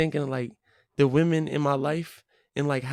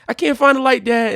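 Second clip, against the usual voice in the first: higher, louder voice of a little daughter; pitched-up imitation of a child's complaint; imitated child calling; 8 semitones higher, 5 dB louder than usual. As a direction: call out to Dad like a flustered kid. Voicing small daughter calling for dad.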